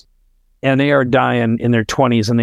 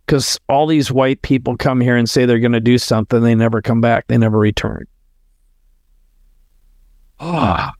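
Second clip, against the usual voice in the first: ordinary delivery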